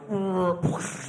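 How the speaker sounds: noise of a market crashing; imitated market crash. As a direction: make the sound of something crashing down. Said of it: "I think the market going".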